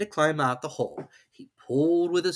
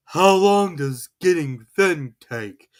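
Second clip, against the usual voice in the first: anxious Pooh; 4 semitones higher, 8 dB louder, slower than usual. A narrator's reading. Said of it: "asked Pooh anxiously".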